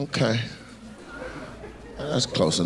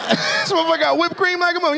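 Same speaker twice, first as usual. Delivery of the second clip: incredulous shout. Real, not acted. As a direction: holler it to the whole room. Shouting to the crowd about the drink.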